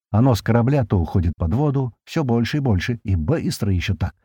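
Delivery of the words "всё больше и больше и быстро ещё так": plain reading voice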